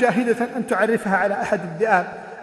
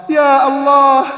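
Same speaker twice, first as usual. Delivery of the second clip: anguished cry; 5 semitones higher, 10 dB louder, much slower than usual. Acted. Father's horrified inner cry.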